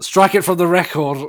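high, loud mock order; hammed-up demand; mock courtroom outburst. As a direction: call it out like an outraged lawyer. Mock courtroom order after a blunder.

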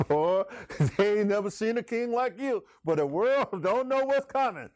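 proud father praising his son with a chuckle; God's voice. Acted. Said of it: "God said".

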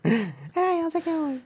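high, slow put-on lady voice; squeaky old lady impression; Donna voice. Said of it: "We'll pretend".

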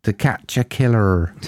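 title said in a broad Devon accent; lightly voiced Devon accent. Shown as a demonstration. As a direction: put on a West Country Devon burr for the title. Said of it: "much better if you say that in Devon accent".

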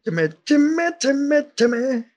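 very high, strained Timmy call; high cartoon imitation of Timmy. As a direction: shout his name like Timmy.

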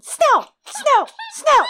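piercing high, loud squawks; repeated short bird screeches; cawing raven. A narrator's reading. Old raven insists on John's name, cawing. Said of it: "it cawed".

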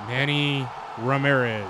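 slow, drawn-out announcer voice; Dodgers announcer. Acted. Co-host announcing the signed player to the room, Dodgers announcer. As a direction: announce the name slowly like a ballpark announcer. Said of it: "is that your Dodgers announcer voice".

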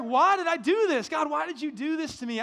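loud, high-pitched retold lament to God; burdened plea. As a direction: cry out in protest, acting out despair. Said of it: "I was so burdened".

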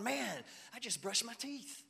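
high, drawn-out mock excitement; so excited. Churchgoer telling pastor trivial news, slow hammed-up excitement. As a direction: announce trivial news like a huge event. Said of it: "run up to me so excited".

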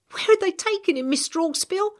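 frightened and pressing; anxious girl. Acted. Girl asking the old man, anxious.

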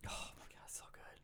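breathless awed whisper; hushed in disbelief. Real, not acted. Awed agreement about the movie.

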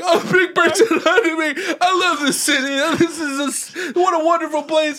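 hammed-up high, squeaky blubbering; mock happy crying. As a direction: wail it as if overcome with joy. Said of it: "And I immediately start crying".

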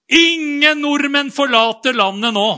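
loud, high, stiff official announcement; mock Erna Solberg. Quoting the prime minister's ban, loud mock announcement. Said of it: "Statsminister Erna Solberg".

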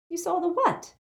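high, sharp disbelief. A narrator's reading. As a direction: snap the question in disbelief. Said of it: "said Hermione sharply".

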